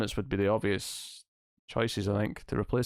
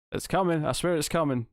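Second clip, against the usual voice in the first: normal talking voice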